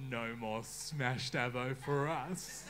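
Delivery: slow, drawn-out mock caption; mock caption read. Mocking friends' house captions, read slowly. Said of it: "It's always".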